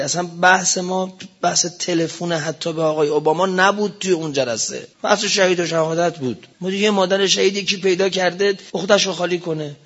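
ordinary talk, nothing marked

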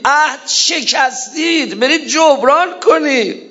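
high, slow, emphatic rebuke; impassioned rebuke. Acted. Preacher voicing Zahra rebuking the men, high and slow.

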